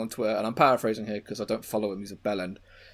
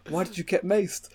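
raised-pitch mock questioning; imitated interviewer; 7 semitones higher than usual. Imitating interviewer questioning maced woman.

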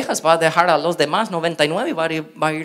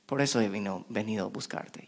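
tender and soft; 6 semitones lower, 10 dB quieter than usual. Retold or imitated. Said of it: "Lo primero".

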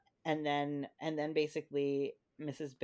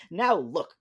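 lightly voiced grumbling walrus, a bit louder; mildly grumpy storybook walrus; 3 semitones higher, 9 dB louder than usual. A narrator's reading. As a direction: read it with a touch of grump. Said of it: "grumped Missus Walrus".

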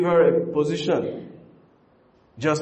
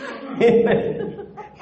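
high laugh; 13 semitones higher than usual. Real, not acted. Preacher laughs at own joke.

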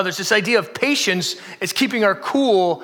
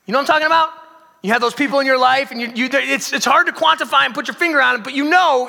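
excited appeal; 6 semitones higher, 4 dB louder than usual. Acted.

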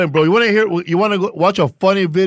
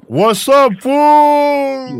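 loud greeting; 9 semitones higher, 5 dB louder, much slower than usual. Real, not acted.

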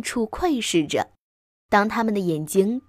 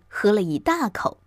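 usual speaking voice

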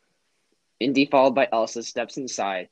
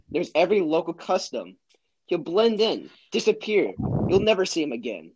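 higher-pitched, emphatic boasting; Indy, confident bluffer; 6 semitones higher than usual. Acted. Indy bluffing Donovan about Marcus, confident boasting. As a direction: build up the friend's skills with bold assurance. Acts Indy in a script scene.

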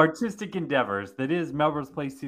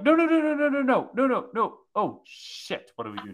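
freaking out, squealing no, no, no; panicked Republicans; 11 semitones higher than usual. Retold or imitated. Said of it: "And they're like".

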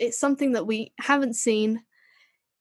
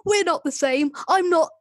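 higher, louder hammed-up Northern snap; Northern Eccleston accent; 7 semitones higher, 4 dB louder than usual. Doctor denies the Dalek, hammed-up Northern accent. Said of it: "warm up my Eccleston accent".

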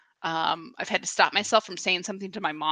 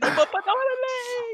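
falsetto squeak. Pretend.

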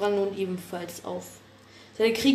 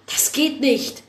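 alarmed cry; 6 semitones higher, 7 dB louder, slower than usual. Acted. Tense clash at gathering.

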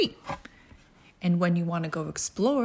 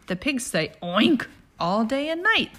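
ordinary delivery, nothing marked

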